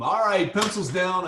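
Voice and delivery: loud call for pencils down; exam proctor. Acted. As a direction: call time like a test proctor. Timer ends drawing exercise.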